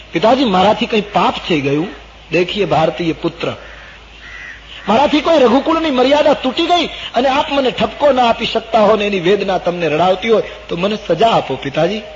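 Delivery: high, loud appeal to a father; impassioned storyteller's plea; anguished pleading. Acted. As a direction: beg him as a distressed son. Ram pleads with his grieving father.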